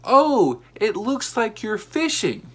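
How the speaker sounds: high, slow friendly call; older lady yells a joke across the park, high and sing-song. Retold or imitated. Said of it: "the lady yells to me".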